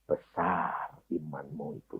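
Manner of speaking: low, soft, slow praise; low hushed praise of faith; quiet praise. Acted. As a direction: say it gently, almost to yourself. Jesus praising her faith.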